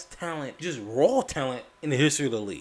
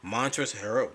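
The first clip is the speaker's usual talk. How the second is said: normal talking voice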